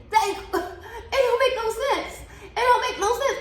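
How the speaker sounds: high, slow, laughing through it; can't believe it, laughing; laughing at the absurd pay delay; laughing disbelief. Real, not acted. Laughing at unpaid check.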